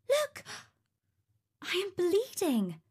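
very high, startled cry; startled exclamation. A narrator's reading. Princess has pricked her finger on the needle, startled exclamation. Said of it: "she exclaimed".

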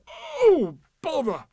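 high, loud wail of frustration; hammed-up stuck-bear groan; exasperated distress. A narrator's reading.

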